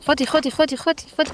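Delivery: high, quick repeated urging; eager urging. Real, not acted. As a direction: coax her to take it, pitch climbing. Urging game character to take.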